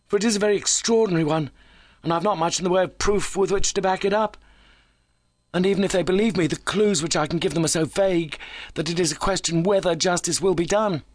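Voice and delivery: high, fast-talking visitor; keyed-up injured visitor. A narrator's reading. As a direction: play him high-pitched and hurried, pouring it out.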